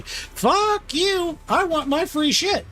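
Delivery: high-pitched mock tantrum; hammed-up squealed refusal; indignant outburst. Acted outburst refusing to give up.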